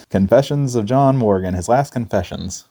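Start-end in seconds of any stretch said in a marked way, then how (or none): none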